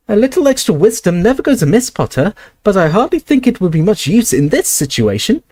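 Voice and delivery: high, tiny professor voice; squeaky Flitwick. Acted. Flitwick brushes off Potter, high and squeaky. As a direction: keep it high and squeaky, dismissive.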